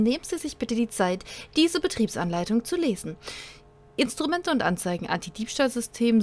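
upbeat advertising announcer voice; glossy commercial manual read. Acted. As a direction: sell the car like a radio spot. Reading in-game car manual aloud.

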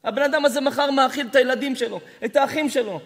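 loud, impassioned sermon; fiery preaching. Acted. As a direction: rebuke the congregation with rising fervour. Preacher builds to impassioned rebuke.

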